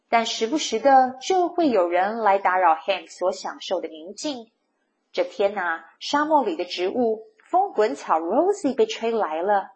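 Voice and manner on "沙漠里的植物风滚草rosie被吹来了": plain reading voice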